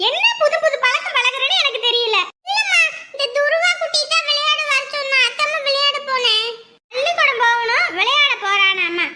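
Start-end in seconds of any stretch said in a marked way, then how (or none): 2.5-6.5 put-on high boy's voice; child answering. Son explaining to mother he went to play, high child voice. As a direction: answer like a little boy excusing himself.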